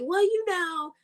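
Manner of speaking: high, coy dodge; squeaky, evasive mock answer; mock Jamala hedging. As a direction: play her squirming out of it. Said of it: "she's gonna be like".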